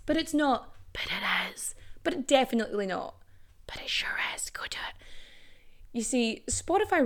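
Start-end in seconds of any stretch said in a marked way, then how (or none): 0.9-1.8 mischievous whispered rebuttal; slipping in the last word under her breath; sneaky whisper. Pretend. Mock argument with herself. 3.7-4.9 whispered nudge to go do it; hushed, conspiratorial egging on; sneaky whisper. Pretend. Mock argument, whispered egging on.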